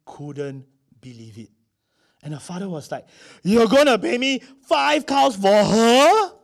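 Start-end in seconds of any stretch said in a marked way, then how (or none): 3.4-6.3 loud, high, drawn-out incredulous shout; shocked disbelief. Acted. Father stunned at five cows for his daughter, shocked disbelief. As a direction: blurt it slowly at full volume, utterly stunned. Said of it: "That's what his reaction was".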